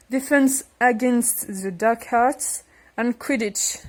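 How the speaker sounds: halting English in a French accent; attempted English accent. Real, not acted. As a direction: read English slowly through a French accent. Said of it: "avec un accent anglais".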